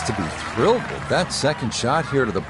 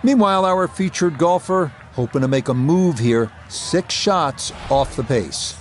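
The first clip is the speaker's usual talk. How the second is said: golf commentator. Acted. Live golf broadcast play-by-play.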